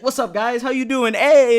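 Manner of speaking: mock hyped greeting that climbs into a loud yell; turned-up stoned guy. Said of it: "he's, like, turned up".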